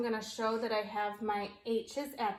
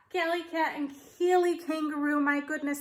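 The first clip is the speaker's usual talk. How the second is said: normal talking voice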